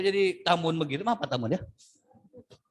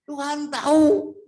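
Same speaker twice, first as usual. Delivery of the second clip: "Tuhan tahu" slow and louder; emphatic repeat; 8 semitones higher, 4 dB louder, slower than usual. Shown as a demonstration. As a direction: land "Tuhan tahu" hard. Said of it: "repeated, building". ("Tuhan tahu" pelan dan keras)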